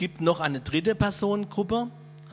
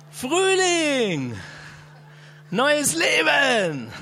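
much higher, louder, drawn-out cry; joyful exclamation; 10 semitones higher, 5 dB louder, much slower than usual. Real, not acted. Preacher proclaims spring to the congregation, jubilant and loud. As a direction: sing out the words like a herald.

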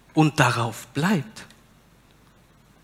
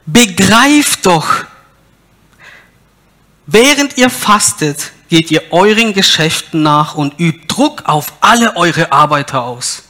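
stern reading; 3 semitones higher, 7 dB louder than usual. Acted. Preacher reads God's rebuke to the fasting people, loud and stern. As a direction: thunder God's rebuke at them.

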